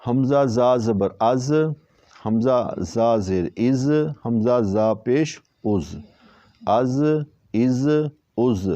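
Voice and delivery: slow "از" with a clear whistling ز; "از" with a sibilant whistle. Shown as a demonstration. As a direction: drill "از", letting the ز hiss like a whistle. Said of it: "اس میں ہم نے سی ٹی کی آواز نکالی ہے".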